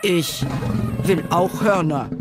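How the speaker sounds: a hint of a pushy elephant; demanding elephant. A narrator's reading. Elephant demands horns too. (leicht fordernder Elefant)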